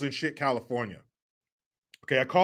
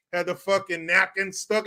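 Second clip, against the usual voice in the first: ordinary talk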